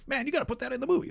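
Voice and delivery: high, fast, hushed excited pitch. Retold or imitated. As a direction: pitch the idea eagerly. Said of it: "and then we're like".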